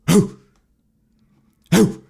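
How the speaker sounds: loud, high hoots; delighted whooping hoo; impressed hoot. Real, not acted. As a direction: let out a couple of excited hoots. Impressed by big names.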